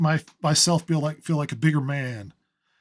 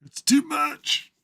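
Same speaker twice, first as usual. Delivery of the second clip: mock swoon; 10 semitones higher, much slower than usual. Mock overwhelmed by ego.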